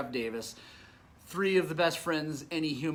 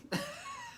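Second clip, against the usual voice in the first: breathy laugh; 10 dB quieter than usual. Real, not acted.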